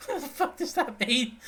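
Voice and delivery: high, laughing outburst of disbelief. Retold or imitated. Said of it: "I'm like".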